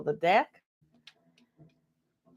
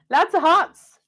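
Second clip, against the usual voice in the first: delighted; 10 semitones higher, 5 dB louder, slower than usual. Real, not acted. Delighted at drawn cards.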